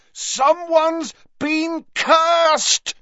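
slow, drawn-out high bellow. A narrator's reading. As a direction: bellow it word by word over the wind. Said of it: "bellowed Harry".

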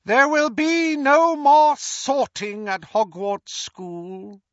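high, slow, cold dark-lord voice; high menacing villain, drawn out; Voldemort gloating. Acted. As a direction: gloat over the fallen hero to the crowd. Voldemort decrees to the crowd.

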